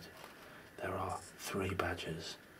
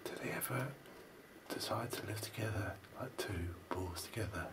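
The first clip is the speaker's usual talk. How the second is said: whispering; 6 dB quieter than usual. Real, not acted. Whispering while watching badgers.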